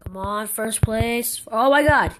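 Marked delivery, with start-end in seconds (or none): 1.5-2.1 loud, higher cry of dismay; exasperated outburst. Real, not acted. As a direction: blurt it out in frustration. Stuck during the game.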